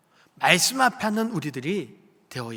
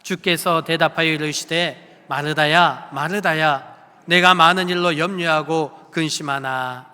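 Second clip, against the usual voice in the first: loud, slow, solemn reading of scripture; scripture read aloud; 8 dB louder, slower than usual. Shown as a demonstration. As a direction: proclaim the verse slowly to the congregation. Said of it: "우리 사십일 절 말씀 함께 봉독합니다". (크고 느리게 봉독하는 성경 낭독)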